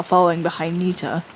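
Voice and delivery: calm storyteller tone; story narration. A narrator's reading. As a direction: read it like stage directions. Narrator reads stage directions.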